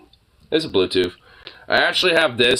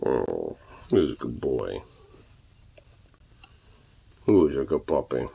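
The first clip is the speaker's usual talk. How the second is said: pet talk; 9 semitones lower, 12 dB quieter than usual. Real, not acted. Owner croons praise at her puppy. Cooing at the dog.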